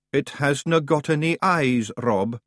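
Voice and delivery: usual speaking voice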